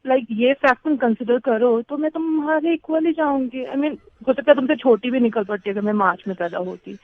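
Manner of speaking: ordinary delivery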